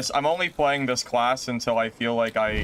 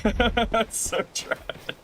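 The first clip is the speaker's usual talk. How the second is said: slow, high, laughing through it; mock-tragic through a laugh; 10 semitones higher, much slower than usual. Real, not acted. Streamer laughing at own death, slow mock-tragic.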